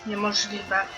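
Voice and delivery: ordinary talk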